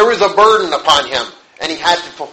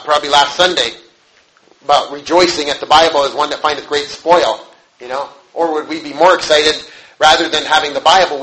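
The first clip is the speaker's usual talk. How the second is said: ordinary delivery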